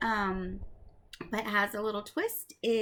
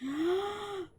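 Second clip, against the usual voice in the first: sharp gasp before a sneeze; 10 semitones higher than usual. Acted. Wolf about to sneeze.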